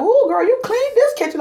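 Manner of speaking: high, loud mock admiration; impressed client gushing. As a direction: gush like a thrilled client. Voicing impressed clients praising her.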